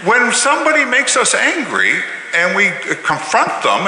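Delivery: usual speaking voice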